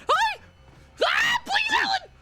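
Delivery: high-pitched panic; panicked squeal. Real, not acted. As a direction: squeal out in sudden alarm. Shrieking in game panic.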